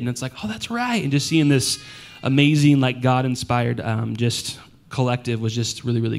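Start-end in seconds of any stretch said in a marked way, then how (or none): 0.4-1.0 much higher, surprised realization. Retold or imitated. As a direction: say it as a sudden realization. Voicing own recognition.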